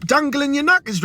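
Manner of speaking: high, exasperated retort; mock exasperated. Lad answers dangling old man, high exasperated retort. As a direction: snap back in disgust. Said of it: "I'm like".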